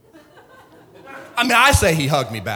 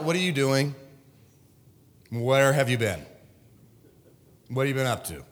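lightly voiced God, a bit lower; God questioning; 4 semitones lower, 4 dB quieter than usual. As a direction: question casually, a touch low, as God. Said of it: "God was like".

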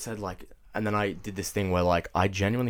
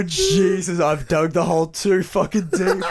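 higher, louder, laughing; 9 semitones higher, 6 dB louder, slower than usual. Real, not acted. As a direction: crack up while saying it. Laughing at own story.

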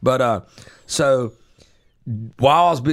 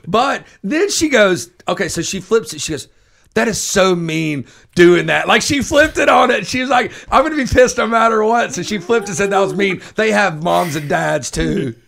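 high, loud mock scolding; loud, high mock scolding of a wife; 6 semitones higher, 6 dB louder than usual. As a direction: play the wife turning the tables, louder. Wife flips it, scolding retold.

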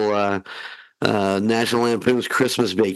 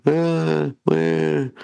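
groggy half-asleep; 6 semitones higher than usual. Retold or imitated. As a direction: sound just woken up. Groggy dad waking to baby.

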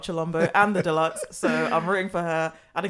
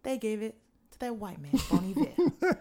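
an exaggerated American accent, climbing higher toward a laugh; 4 semitones higher than usual. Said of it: "my accent's gone".

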